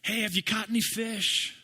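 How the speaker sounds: high, called-out voice across the water; calling out to fishermen from the shore; mock call out. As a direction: shout it to the boat, lightly hammed. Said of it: "he yells out to this boat of fishermen".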